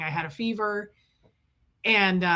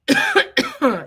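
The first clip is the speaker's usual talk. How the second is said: a sudden harsh cough; sick cough; 6 dB louder than usual. Real, not acted. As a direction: cough hard mid-story.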